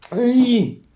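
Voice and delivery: high, loud heckling shout; mimicked yelling. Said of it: "that's how much you were yelling".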